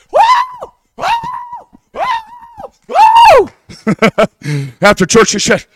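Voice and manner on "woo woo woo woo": retold shrieking high woos; ecstatic woo. Pastor imitating Pat's high joyful woo in church. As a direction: mimic her spirit-filled squeals. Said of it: "Pat goes".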